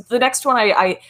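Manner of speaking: usual speaking voice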